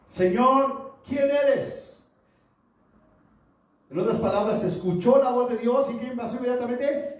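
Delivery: high, loud pulpit cry; full-force preaching. Acted. Preacher retelling Saulo's encounter with the voice, full-force preaching. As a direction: proclaim it to the congregation, voice lifted high.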